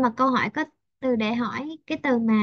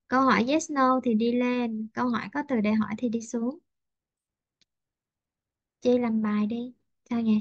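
usual speaking voice

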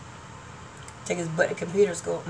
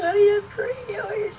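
neighbor's tender praise; 12 semitones higher, much slower than usual. Retold or imitated. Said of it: "She's like".

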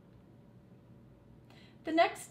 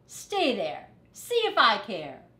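louder, sulky and dismissive; loud sulky retort; huffy shout; 4 semitones higher, 9 dB louder than usual. Acted. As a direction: throw it back over his shoulder. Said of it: "shouted Rat".

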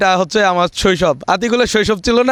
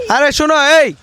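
yelling across the room; 6 semitones higher than usual. Real, not acted. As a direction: shout so they wait. Calling kids who walk away.

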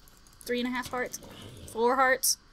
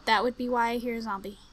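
ordinary talk

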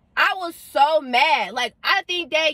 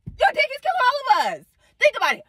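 high exasperated cry; 12 semitones higher than usual. Real, not acted. Angry repeat, voice jumps high.

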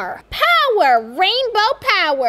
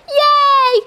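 cheer; 10 semitones higher, 4 dB louder than usual. Acted. Kids cheering at showcase start.

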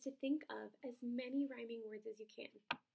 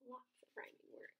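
soft whispered aside; 12 dB quieter than usual. Real, not acted. Teacher aside to the children, whispered. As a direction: lean in and whisper it.

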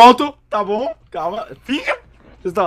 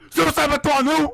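mock shouting; 5 semitones higher than usual. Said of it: "O cara começa o espetáculo".

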